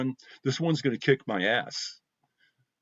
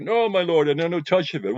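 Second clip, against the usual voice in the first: raised, higher stage reply; Guildenstern; 9 semitones higher, 6 dB louder than usual. Retold or imitated. A guest recites Guildenstern's refusal to Hamlet, raised and high. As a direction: declaim the refusal up high and loud. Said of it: "And they say".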